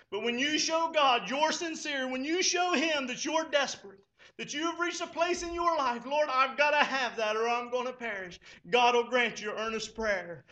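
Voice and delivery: loud, rising prayer at full preaching force; impassioned pulpit prayer; fervent preaching. Acted. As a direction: cry out the prayer to the Lord.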